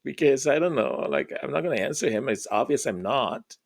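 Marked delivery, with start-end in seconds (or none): none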